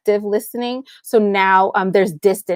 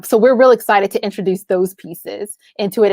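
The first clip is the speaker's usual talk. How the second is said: ordinary talk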